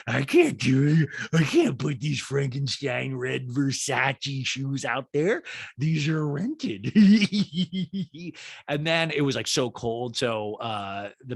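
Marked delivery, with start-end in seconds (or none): 0.1-6.9 slushy-mouthed mock reality star; Lisa Rinna. Reality star refuses to wear rented shoes outside, slurred impression. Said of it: "Lisa Rinna was like". 7.0-8.6 high, loud put-on cackle; Rinna-esque cackle. Host mocks reality star, loud high imitation cackle. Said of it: "the laugh is kinda Rinna-esque".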